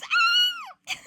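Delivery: very high, squealed cry; comic scream. Acted. Hearing the check will bounce, comic scream. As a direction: scream in mock horror.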